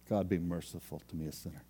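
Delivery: soft penitent prayer fading out; humble whisper-plea. Retold or imitated. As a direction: plead quietly for mercy.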